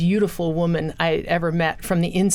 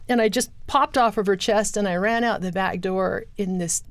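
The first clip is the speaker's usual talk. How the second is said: usual speaking voice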